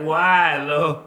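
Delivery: playful woeful cry; mock crying plea. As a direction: plead to heaven, hammed-up. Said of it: "I stayed on the corner crying".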